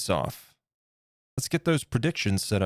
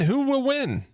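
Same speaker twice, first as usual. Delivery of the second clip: much higher, squealing hype question; high excited hype; 12 semitones higher than usual. Acted. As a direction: pitch it way up like a game show host. Hyping viewer predictions.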